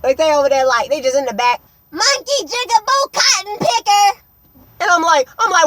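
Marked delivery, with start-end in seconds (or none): 1.9-4.2 high, loud imitation of hecklers; mock yelling. Imitating people yelling slurs at the back of the bus, mock yell. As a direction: mimic a high-pitched jeering yell. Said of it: "just yelling the N-word".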